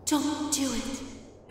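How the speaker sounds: a faint, slow ghostly warning; ghost's warning. Retold or imitated. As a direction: breathe it out like a ghost warning her. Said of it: "she looked at me and said".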